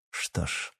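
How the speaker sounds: very soft, almost whispered; hushed resignation. A narrator's reading. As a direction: let it out under the breath. Father's ominous pause before acting.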